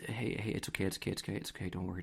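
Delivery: soft, hushed repeated reassurance; imitating consoling dad. Dad holding his crying daughter, acted gentle consoling. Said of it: "tries to console her".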